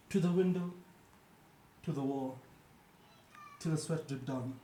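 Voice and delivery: measured spoken-word delivery with long pauses; poetry recital. Acted. Host performs an unhinged poem for co-hosts, poetry recital. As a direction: deliver each short line as a separate beat.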